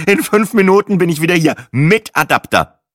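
loud, high, impatient announcement; snorting. A narrator's reading. Guest rushing off for an adapter, loud and impatient. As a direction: call it out, slowing on the last words. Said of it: "schnaubte ich und eilte zur Tür".

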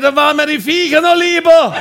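high, loud neighbour's yell; mock exasperated shout. Neighbour fed up with the pet names, mock exasperated shout. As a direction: bellow it up high over the fence. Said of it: "Der Nachbar geschrien".

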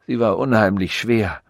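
ordinary delivery, nothing marked

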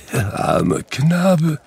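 high, slow, tearful lament; crying over his younger self; weeping. A narrator's reading. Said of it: "und er weinte".

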